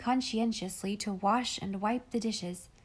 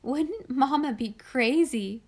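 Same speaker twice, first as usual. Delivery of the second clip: loud, drawn-out giddy delight; high-spirited and gleeful; hilarious; 3 semitones higher, 5 dB louder, slower than usual. A narrator's reading. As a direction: exclaim it with hilarity, slowly and loudly. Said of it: "she said hilariously".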